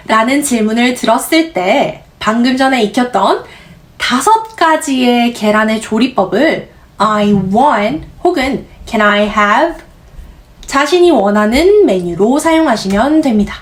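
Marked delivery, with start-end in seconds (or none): none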